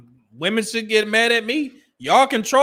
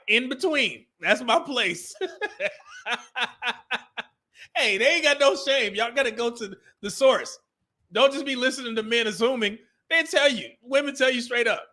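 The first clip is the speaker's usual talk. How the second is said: normal talking voice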